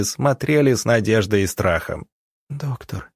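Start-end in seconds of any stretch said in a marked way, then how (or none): 2.5-3.1 faint, higher and much softer; hushed, frail plea; whispered. A narrator's reading. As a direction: whisper weakly to the doctor. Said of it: "прошептала она".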